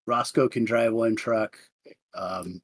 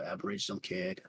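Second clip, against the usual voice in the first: usual speaking voice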